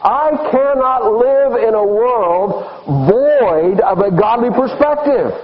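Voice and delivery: high, very drawn-out preaching cry; emphatic declaration. Acted. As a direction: stretch the key words out slowly, pitched high. Psalmist's grief declared emphatically in sermon.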